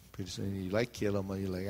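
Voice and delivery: usual speaking voice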